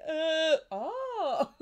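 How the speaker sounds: put-on high, loud startled baby "uh, oh"; mock baby whimper. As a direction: voice the baby teetering toward tears. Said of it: "they're like".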